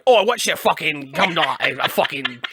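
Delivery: hammed-up Cockney alien; Cockney accent. Joking as an alien to co-host, Cockney accent. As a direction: put on a rough-and-ready Cockney accent. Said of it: "they're never Cockney".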